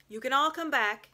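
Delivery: loud, high call across the hill; lion calling everyone back. A narrator's reading. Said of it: "he said".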